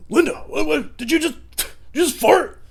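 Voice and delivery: high, loud incredulous voice; a husband jolted awake, high and outraged; shocked husband. Pretend. Husband woken up asking Linda if she farted, high and shocked. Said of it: "He'd be like".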